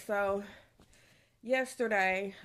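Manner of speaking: ordinary talk, nothing marked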